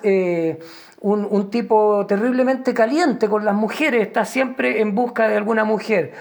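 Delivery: ordinary talk, nothing marked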